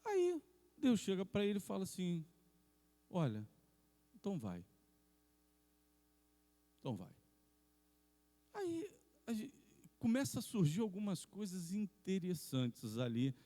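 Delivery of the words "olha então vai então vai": low, soft, measured voice of God; God's voice. Acted. God answering the man who came to consult, low and quiet. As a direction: give permission low and quietly, with pauses. God's reluctant consent, acted.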